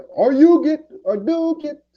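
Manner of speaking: high, loud mocking squeal; a sudden high mock yelp. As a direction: squeak it out to ridicule him. Mocking a rival's moves.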